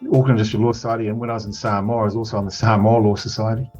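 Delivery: ordinary delivery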